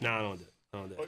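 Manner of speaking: low, soft reluctant mumble; imitated reluctant refusal. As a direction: shrink away from the request, softly. Acts out colleague's refusal on camera.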